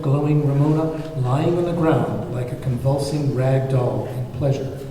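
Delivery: ordinary delivery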